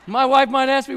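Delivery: ordinary delivery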